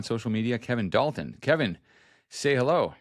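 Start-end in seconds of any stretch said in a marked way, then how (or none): none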